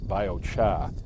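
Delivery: New England "biochar" with the r dropped, BY-oh-chah; New England accent. Shown as a demonstration. Showing viewers his home New England way, r dropped. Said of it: "Where I'm from in New England".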